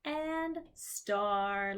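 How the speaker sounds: six and seven, very slowly; slow sight words. Shown as a demonstration. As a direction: spell it out slowly for kids. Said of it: "Two of our sight words".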